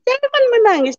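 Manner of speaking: high-pitched reenacted hushing; urgent plea, retold. Mother tells crying daughter not to cry, urgent plea. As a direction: plead suddenly, pitch leaping up.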